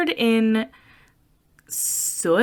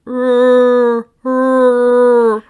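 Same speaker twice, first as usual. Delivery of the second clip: loud, higher imitated Wookiee roar; Wookiee growl; 5 semitones higher, 10 dB louder than usual. Imitating noises from the tank, loud Wookiee growl. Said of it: "almost Wookiee noises".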